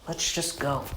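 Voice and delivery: curt quiet reply. Retold or imitated. Natsu's curt reply to the grieving Elfman.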